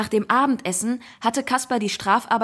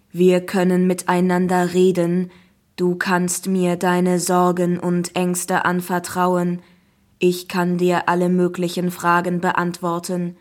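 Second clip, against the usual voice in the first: monotone computer helper, louder and measured; friendly talking app; 3 semitones lower, 5 dB louder than usual. Acted. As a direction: promise evenly, like a machine. Zeus app's voice. (monotone, freundliche Computerstimme)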